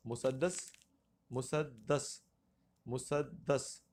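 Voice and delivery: مسدس repeated as "mu-sad-das"; pronunciation demo. Shown as a demonstration. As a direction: say مسدس split after "musad", with a doubled d. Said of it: "یہ کون سا ہے".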